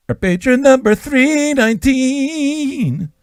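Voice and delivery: high, slow hammed-up announcement; mock announcer. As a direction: announce the number like a grand game-show host. Announcing patron over music.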